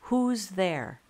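loud aggressive challenge; husband confronting an intruder he can't see. A narrator's reading. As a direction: bark it into the dark. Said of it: "In a louder, more aggressive voice".

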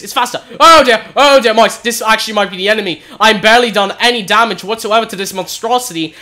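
ordinary delivery

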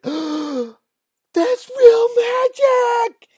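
very high, loud, drawn-out shriek; panicked boys' scream. Acted. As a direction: scream it like terrified kids about to bolt. Boys panic at real magic.

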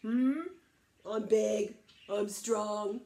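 loud, defiant big brother; tough big goat. Acted. Biggest goat confronting the troll, loud and defiant. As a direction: boast it forcefully, squaring up to him.

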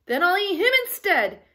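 high, loud, fast decision; troll crying out. A narrator's reading. Troll chooses the bigger goat, crying out. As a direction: blurt it out loudly and quickly. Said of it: "he cried".

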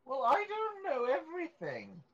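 high, indignant protest; defensive. Real, not acted. Friend defending not knowing cordite, high indignant protest. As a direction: push back, mock offended.

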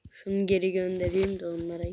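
normal talking voice

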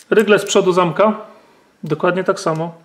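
usual speaking voice

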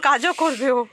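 loud, level, settled repeat; loud statement. Real, not acted. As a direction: call it back as a fact. Restating reply, settled.

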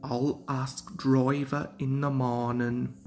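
soft, drowsy murmur; half asleep, to himself; sleepy. Acted. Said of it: "he said to himself sleepily".

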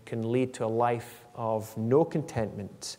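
ordinary talk

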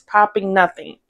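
ordinary delivery

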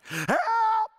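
high, loud desperate cry for help; shout. Retold or imitated. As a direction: yell it out to God. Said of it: "I shouted".

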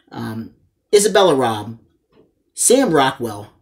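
ordinary talk, nothing marked

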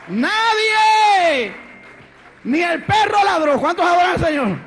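loud, high preacher's proclamation; fiery preaching. Acted. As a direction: preach it out to the congregation. Preaching at full force.

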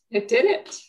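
really moved, struggling to regain composure; welling up and embarrassed by it; so emotional. Real, not acted. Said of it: "I'm so emotional".

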